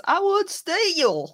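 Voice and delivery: imitating [name]. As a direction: stretch it out like the singer on the record. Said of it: "The way [name] says".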